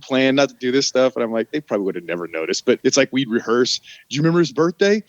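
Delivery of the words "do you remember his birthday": quizzing a friend. Retold or imitated. Rehearsing fake ID details.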